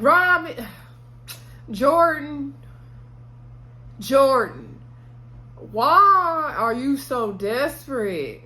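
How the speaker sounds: high, slow, whiny mock crying, pleading with her; mock despair. Recapper pleading with Jordan on screen, in mock tears. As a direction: wail her name like you're fed up with her. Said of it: "And I'm like".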